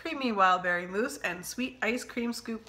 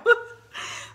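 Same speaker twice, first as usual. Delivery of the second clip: breathless laugh; 14 semitones higher, 5 dB louder than usual. Real, not acted. Laughing at the long video.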